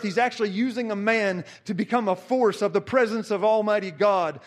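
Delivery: ordinary delivery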